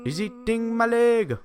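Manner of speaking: high, slow mock African singing accent. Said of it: "of the African voice".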